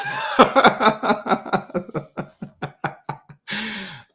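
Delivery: high burst of real laughter trailing away; long, helpless cackling laugh. Real, not acted. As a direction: laugh hard at your own tease. Laughs at own teasing comeback.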